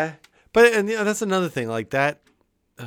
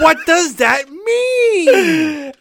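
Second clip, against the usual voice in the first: laughing; 10 semitones higher, 5 dB louder, much slower than usual. Real, not acted. Laughing disbelief at the quote.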